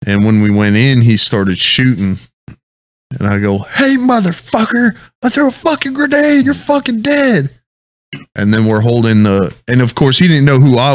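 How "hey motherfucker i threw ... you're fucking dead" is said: mock yell. Yelling at the soldier who ignored his grenade, high mock yell. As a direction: shout it up high like calling out a dead man.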